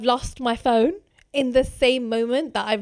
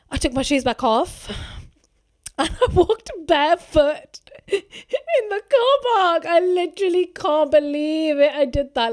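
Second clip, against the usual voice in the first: much higher and louder, through laughter; laughing disbelief; 6 semitones higher, 7 dB louder than usual. Real, not acted. Laughing at barefoot walk memory.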